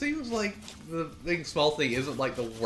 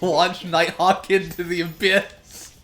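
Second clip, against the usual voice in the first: excited; 3 semitones higher, 6 dB louder, slower than usual. Real, not acted. Gaming, launched enemy.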